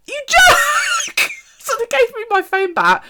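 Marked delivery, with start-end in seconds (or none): 0.1-1.3 very high, re-enacted outcry; mock indignant shout. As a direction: yell at the photobomber in fun. Retold retort to photobomber.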